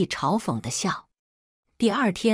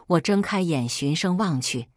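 usual speaking voice

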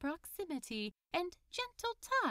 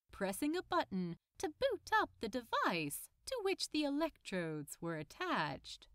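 ordinary delivery, nothing marked

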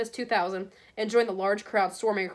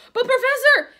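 high, loud desperate cry; shouted protest; 11 dB louder than usual. A narrator's reading. Oliver Wood shouting at McGonagall in protest. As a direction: shout across the pitch in dismay. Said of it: "he shouted".

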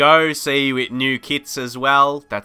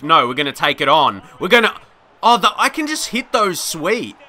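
excited; 6 semitones higher, 6 dB louder than usual. Real, not acted. Excited at nice play.

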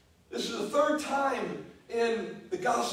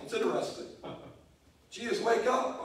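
ordinary talk, nothing marked

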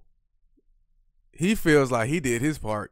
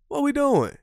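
high incredulous squeak; exasperated. Real, not acted. Host baffled by the two-million deal, incredulous high. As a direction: throw up your hands in disbelief.

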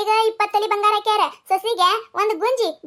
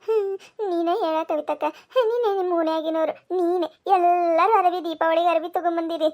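tearful complaint; 3 semitones lower than usual. Acted. Daughter-in-law appeals to neighbour Kavita about Diwali, tearful. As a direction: plead through tears, slowing down. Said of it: "ಎದಕ್ ಅಳಾಕತ್ತೇವ".

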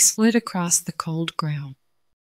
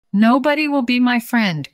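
tearful cry; 7 semitones higher, 4 dB louder than usual. Acted. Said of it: "cried Snowball".

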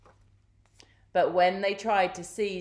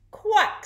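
short, high duck quack; 7 dB louder than usual. A narrator's reading. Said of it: "said the duck".

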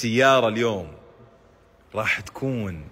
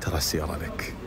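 leaning in to whisper; 9 semitones lower, 8 dB quieter, much faster than usual. Real, not acted. Telling a secret, don't tell anyone.

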